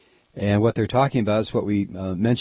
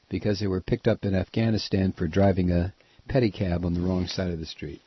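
normal talking voice

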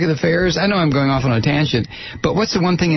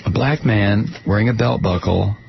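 usual speaking voice

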